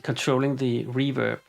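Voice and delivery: ordinary talk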